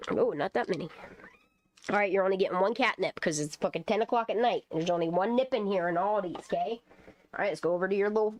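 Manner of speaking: ordinary delivery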